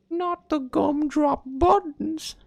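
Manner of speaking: squeaky, very high, slow pleading; tiny cookie voice, very high and drawn out; Gingerbread Man. Retold or imitated. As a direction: beg like a tiny cookie being tortured. Gingerbread Man pleading about torture.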